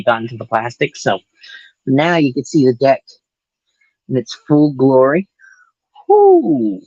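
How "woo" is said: high, loud woo; excited whoop. Real, not acted. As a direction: let out a happy cheer. Cheers at the revealed deck.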